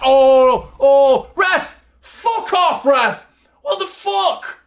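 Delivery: screaming at the ref, very high and loud; furious shout. Real, not acted. Fan screaming at the referee over the tackle, furious. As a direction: rage at the ref for the foul.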